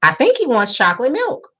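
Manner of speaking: a boy speaks up for the new kid, lightly voiced; helpful classmate. A narrator's reading. Lightly voiced young boy. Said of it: "the boy behind him announced".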